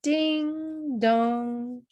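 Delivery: ordinary delivery, nothing marked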